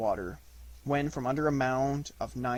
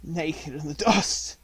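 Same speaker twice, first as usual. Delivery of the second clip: coughing; 5 semitones higher, 8 dB louder than usual. A narrator's reading. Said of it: "coughed Akela".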